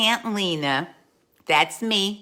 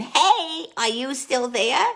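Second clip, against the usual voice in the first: put-on child's voice; 6 semitones higher, slower than usual. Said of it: "the voice says it again".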